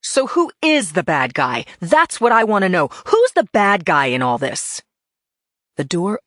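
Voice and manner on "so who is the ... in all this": loud, furious demand; exploding in anger. Acted. Detective to her husband's boss, exploding in anger. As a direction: hurl the question at him. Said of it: "I exploded".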